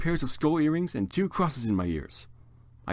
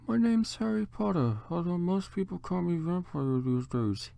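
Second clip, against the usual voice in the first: put-on higher, slower, odd voice for the boy; grumbled; 6 semitones higher, 3 dB quieter than usual. A hint of a moody gothic Harry, slower higher voice. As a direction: mutter the name moodily. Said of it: "he grumbled".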